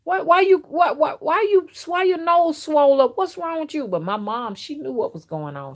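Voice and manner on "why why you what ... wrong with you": high, loud, stammering mimicry of a father; imitating dad. Dad asking about his child's swollen nose, imitated high and stammering. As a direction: mimic dad's startled questioning, pitched way up. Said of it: "'Cause that's how he talked".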